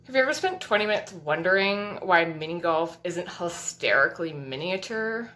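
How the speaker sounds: usual speaking voice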